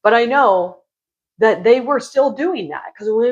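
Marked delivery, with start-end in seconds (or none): none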